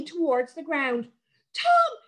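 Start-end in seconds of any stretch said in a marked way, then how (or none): 1.5-2.0 very high, loud scream of his name; a girl screaming as her brother falls; shout. A narrator's reading. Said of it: "shouted Sarah".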